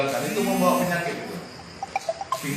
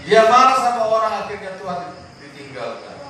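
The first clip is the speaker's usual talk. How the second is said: loud, higher preacher's delivery; preaching at full force; 6 semitones higher, 6 dB louder than usual. Acted. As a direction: hammer the warning to the congregation. Preaching on anger. (khotbah berapi-api)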